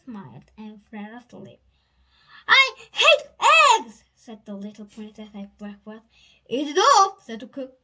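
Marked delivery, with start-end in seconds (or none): 2.5-4.0 high, loud protest; loud refusal. A narrator's reading. Little princess refusing her breakfast, loud high protest. 6.5-7.2 high, forceful order; loud urging. A narrator's reading. Cook pushing the princess to eat, loud urging.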